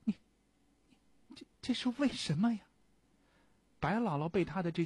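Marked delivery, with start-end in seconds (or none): 0.0-2.6 high, fast stammering disbelief; shocked stammer. A narrator's reading. Old friend stammers in shock at the prisoner. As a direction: stumble over the words in shock.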